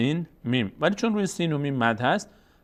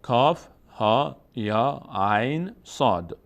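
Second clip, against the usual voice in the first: کاف، ها spoken slowly; deliberate spelled recitation; slow letter reading; much slower than usual. Shown as a demonstration. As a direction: read each letter name slowly. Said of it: "در ابتدای سورهٔ مبارکه مریم".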